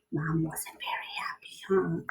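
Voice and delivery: hushed conspiratorial whisper; whispered aside. Real, not acted. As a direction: lean in and whisper it like a secret. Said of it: "whispered aside about Mom".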